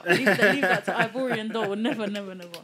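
loud laughing never never, refusing to rap; laughing refusal. Real, not acted. Laughing off the idea of rapping for the listeners, loud and laughing.

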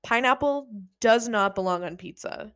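ordinary delivery

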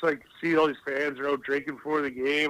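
ordinary talk, nothing marked

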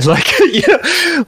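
high, slow words through a laugh. Real, not acted. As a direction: laugh as you say it. Laugh heard on line.

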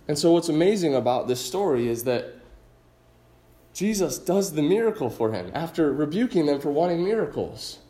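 ordinary talk

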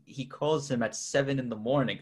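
ordinary talk

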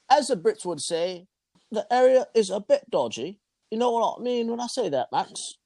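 high-pitched hammed-up British voice; bad English accent. Telling Max about a dodgy area in a mock British accent. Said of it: "is that your English accent?".

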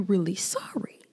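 hushed, pointed "are you really sorry"; whispered question. Real, not acted. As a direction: drop to a whisper on the doubt. Rhetorical challenge to an apology.

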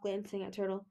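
usual speaking voice

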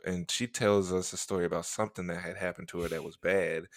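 normal talking voice

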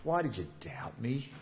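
quiet, breath-only question; hushed words to a sinking friend; gentle whisper. Acted. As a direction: whisper it as a soft rebuke. Jesus gently rebukes Peter.

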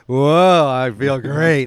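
loud, slow, blissed-out whoa; blissed-out excitement. Retold or imitated. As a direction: play a happy drugged patient. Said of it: "It wasn't like".

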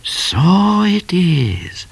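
high, very drawn-out knowing cat; wise voice. A narrator's reading. Cat confirming what Midnight saw, wise voice. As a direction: stretch every word out sagely, up high. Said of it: "said Cat in a wise voice".

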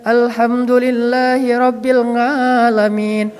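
put-on slow Quran reading in an Indonesian accent, Alamin as "ngalamin"; mock Indonesian accent on Arabic recitation. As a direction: imitate an Indonesian reciter turning the ain into "ng". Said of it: "Mungkin karena kita orang Indonesia kan".

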